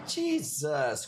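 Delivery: ordinary talk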